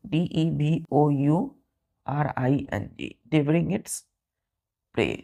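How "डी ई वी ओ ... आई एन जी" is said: slow, letter by letter spelling. Shown as a demonstration. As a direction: spell it out slowly for the class. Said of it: "ध्यान दीजिए". (धीरे-धीरे अक्षर बोलते हुए)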